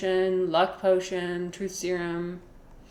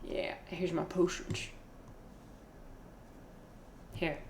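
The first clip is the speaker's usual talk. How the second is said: whisper; 13 dB quieter than usual. Real, not acted. Quietly finding the file.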